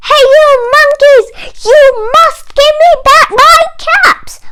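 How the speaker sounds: high, loud, fast shout. Acted. Peddler shouting up at the monkeys, high, loud and fast. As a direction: bellow at the monkeys in a rage. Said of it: "and shouted".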